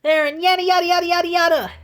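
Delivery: high, loud mocking rant; mocking his yelling. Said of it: "And he's like".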